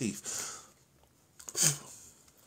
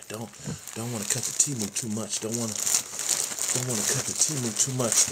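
ordinary talk

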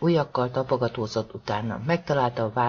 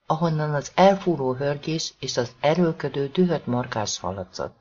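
ordinary delivery

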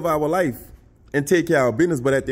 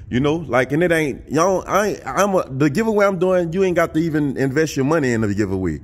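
usual speaking voice

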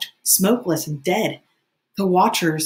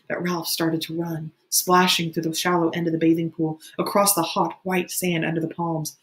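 plain reading voice

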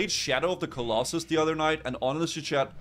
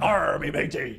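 pirate voice; 4 dB louder, slower than usual. Pretend. Pirate banter about rum.

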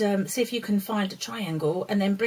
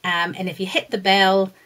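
louder, bright presenter read; outro announcement; 6 dB louder than usual. Acted. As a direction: greet parents like a channel host. Channel outro to parents.

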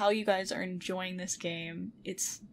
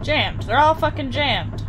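loud exasperated complaint; fed up, nearly yelling; frustrated; 6 semitones higher, 9 dB louder than usual. Real, not acted. All doors stuck.

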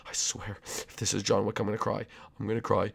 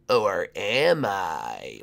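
mysterious tease; 7 semitones lower, 5 dB quieter, much slower than usual. Acted. Leak skin teasing mysteriously.

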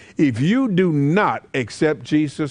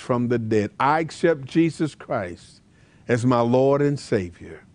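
prayer; 4 semitones lower than usual. Acted. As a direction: lead the listener gently in repentance. Leads the sinner's prayer.

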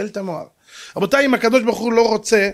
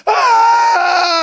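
loud, high acted wail; heartfelt scream; 13 semitones higher, 6 dB louder than usual. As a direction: scream as if life savings just vanished. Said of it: "זו צעקה שיוצאת מהלב". (זעקת שבר מהלב)

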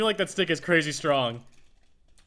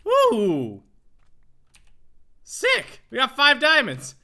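high, loud whoop of triumph; excited celebration; 7 semitones higher, 8 dB louder, much slower than usual. Real, not acted. Player celebrating a diamond find, thrilled. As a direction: cheer loudly in a high, thrilled voice.

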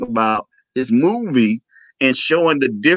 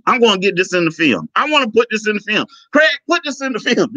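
fast, high, eager mimicry; excited; 11 semitones higher, faster than usual. Filmmaker telling Craig to film this, excited. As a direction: rattle it out, rising with each repeat.

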